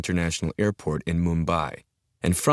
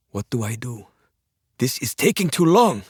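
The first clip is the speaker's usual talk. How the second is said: stressed panic; 6 semitones higher, 4 dB louder than usual. Acted. Stressed hijacker losing patience.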